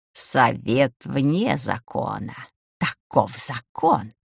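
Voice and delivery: low, slow, rustling otherworldly voice; a whispery voice from beyond; eldritch horror. Acted. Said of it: "шелестящим потусторонним голосом".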